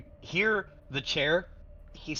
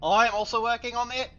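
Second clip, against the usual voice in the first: raised, higher call; calling across the room; 10 semitones higher, 6 dB louder than usual. Acted. [name] chiming in from across the room, calling out. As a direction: pipe up loudly from across the room. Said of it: "from across the room, just goes".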